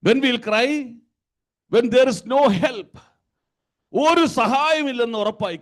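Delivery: high, loud sermon build; full-force preaching. Acted. Preacher telling believers when we cry, forceful preaching. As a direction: drive each phrase at the congregation.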